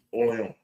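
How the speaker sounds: "Orleans" softly as or-lee-ON; French pronunciation. Shown as a demonstration. Teaching the French name, "Orleans" as soft or-lee-ON. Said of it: "how they say it in French".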